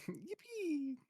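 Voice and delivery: high squeaky yippee imitation; yay. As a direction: copy the yippee meme sound. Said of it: "made the yippee sound effect".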